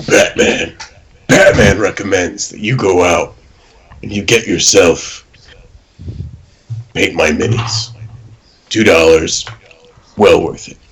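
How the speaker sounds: hushed, rasping superhero voice; Batman. Pretend. App recommendation on request, as Batman. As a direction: growl it out in short, weighty phrases. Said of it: "do the Batman voice".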